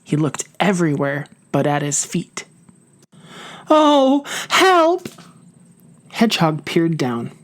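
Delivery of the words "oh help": high, loud frightened cry; a sudden panicked call for help. Acted. Mouse falls into hole.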